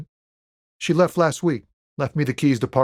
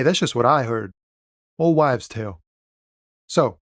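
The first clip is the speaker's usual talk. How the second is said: ordinary talk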